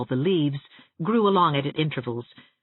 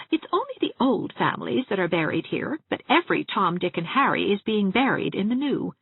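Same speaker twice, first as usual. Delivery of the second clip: put-on higher, chatty gossiping old dressmaker; higher-voiced prim spinster relishing every funeral; Miss Valentine; 7 semitones higher than usual. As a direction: gossip cheerfully about the dead.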